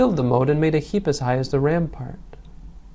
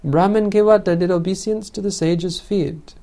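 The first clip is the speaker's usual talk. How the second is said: raised crowd voice, higher; crowd shout; 5 semitones higher, 3 dB louder than usual. A narrator's reading. The people proclaiming to all, a raised shout. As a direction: call it out as the whole crowd. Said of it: "shouted aloud with one voice".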